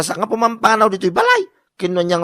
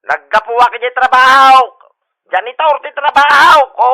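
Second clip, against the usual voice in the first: megaphone shout to neighbours; 5 semitones higher, 9 dB louder than usual. Acted. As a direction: holler over the megaphone at the neighbours. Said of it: "Nagpigsamot din ti megaphone na". (pukkaw kadagiti kaarruba iti megaphone)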